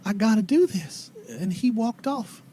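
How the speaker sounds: put-on wistful old mother recalling her boy; old townswoman.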